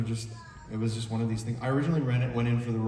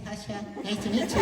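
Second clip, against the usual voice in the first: put-on a higher, sweet little girl greeting; 11 semitones higher, 5 dB quieter than usual. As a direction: play the shy twelve-year-old saying hi. Imitating young co-star greeting.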